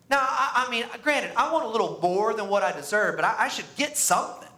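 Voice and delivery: high, smug, self-righteous boasting; mocking Pharisee. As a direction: puff yourself up like a proud Pharisee. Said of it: "we live like the Pharisees do".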